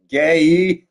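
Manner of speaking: loud taunting jab; teasing shout. Pretend. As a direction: bark it out as a tease.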